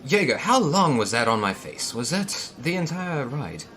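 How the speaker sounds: a touch of put-out disbelief; exasperated Mao. Acted. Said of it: "wiping off his forehead and saying".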